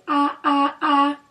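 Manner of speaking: doctor modelling "ah" for the patient; open ah. Shown as a demonstration. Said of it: "open for me and say".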